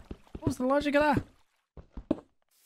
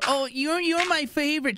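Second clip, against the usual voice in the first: high mock fan voice; gushing fan imitation; 10 semitones higher than usual. Teasing a viewer who came from Nogla's stream, mock gushing. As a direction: pretend to fawn in a raised pitch.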